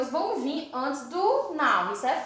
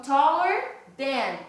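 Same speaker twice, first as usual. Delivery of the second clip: taller than said slowly and clearly; slow model phrase; much slower than usual. Shown as a demonstration. Teacher models comparative for class, slow and clear. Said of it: "Então".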